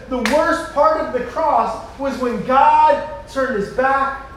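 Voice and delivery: 1 emphatic preaching. Acted. Builds to a sermon climax.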